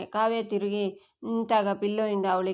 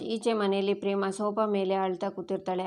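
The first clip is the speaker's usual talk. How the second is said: ordinary delivery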